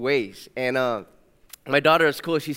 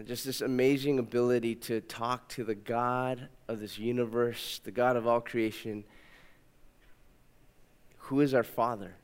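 soft, lower prayer voice; 4 semitones lower, 6 dB quieter than usual. Acted. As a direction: pray quietly and sincerely. Leads prayer.

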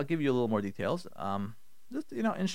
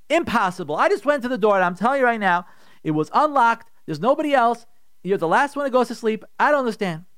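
higher, insistent argument; acted angry husband; 6 semitones higher, 4 dB louder than usual. Husband insisting to wife it was unlocked, angry. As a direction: press the accusation hard. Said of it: "in his anger, in his kas".

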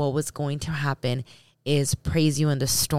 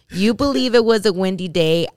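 louder, higher, playful defiance; emphatic rebuttal; 4 semitones higher, 7 dB louder than usual. Real, not acted. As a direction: push back loudly at the doubters. Rebuts imagined doubters.

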